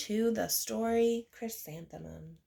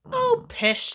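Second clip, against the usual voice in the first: high loud scoff; dismissive mother; 13 semitones higher, 6 dB louder than usual. Acted. Mother scoffing at her daughter's worries. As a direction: brush it off lovingly.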